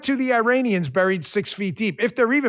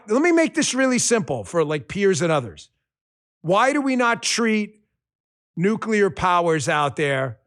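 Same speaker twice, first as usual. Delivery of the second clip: ordinary talk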